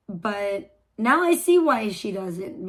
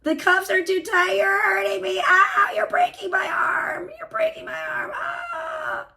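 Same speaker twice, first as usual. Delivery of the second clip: high wailing cries of pain; arm twisted back; 11 semitones higher, 5 dB louder than usual. Retold or imitated. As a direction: shriek like your arm is being wrenched. Acted arrest, arm being twisted.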